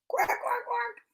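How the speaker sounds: high, quiet attempt at quacking; poor duck quack. Reader trying duck sounds for kids, not very good quacking. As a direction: quack softly and badly, way up high. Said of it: "I really can't quack that well".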